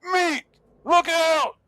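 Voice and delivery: very high, loud shout across a pool; high-pitched full-voiced holler; yelled warning. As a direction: yell an urgent warning to a friend. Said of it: "I'm gonna yell to him".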